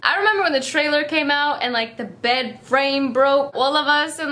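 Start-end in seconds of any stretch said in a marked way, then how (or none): none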